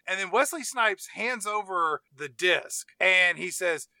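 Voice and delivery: ordinary talk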